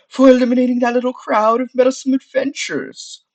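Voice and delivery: high, loud voice calling from afar; sultry sorceress shout. Acted. Sorceress thanking the adventurer from above, loud high call. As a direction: project it grandly from high above. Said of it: "distant, but still sultry voice".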